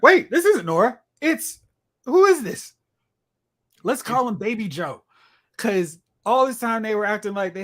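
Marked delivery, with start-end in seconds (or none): 0.0-5.0 higher, lightly acted confusion; mock surprise. Heroes finding a baby boy instead of Nora, mock surprised. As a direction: act out a startled double take. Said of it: "They're like".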